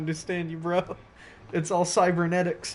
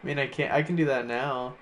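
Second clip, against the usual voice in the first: usual speaking voice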